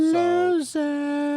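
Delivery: mocking taunt. Mocking the loser.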